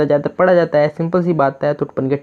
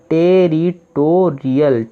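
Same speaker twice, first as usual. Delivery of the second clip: Territorial stretched out slowly syllable by syllable; teacher revealing the finished word; slow full word; much slower than usual. Shown as a demonstration. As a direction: say the whole word slowly and clearly. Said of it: "तो क्या बना?".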